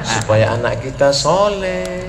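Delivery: very soft, low, drawn-back preaching; hushed, low demonstration of a mild sermon; gentle preaching. Shown as a demonstration. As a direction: preach so softly the congregation could doze off. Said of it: "mau ceramah yang lembut-lembut".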